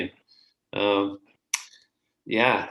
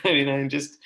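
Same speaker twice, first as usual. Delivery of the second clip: laughs through the words, a little louder; real amused laugh breaking into speech; 4 semitones higher, 7 dB louder than usual. Real, not acted. Teasing the host about his cold calling, laughing.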